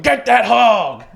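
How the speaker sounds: loud, slow country holler; good old boy yell. Retold or imitated. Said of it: "a bunch of good old boys going".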